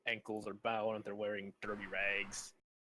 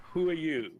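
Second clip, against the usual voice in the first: loud, high challenge to a stranger; stern demand; 6 semitones higher, 7 dB louder than usual. Acted. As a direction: halt him with a sharp question. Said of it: "and says".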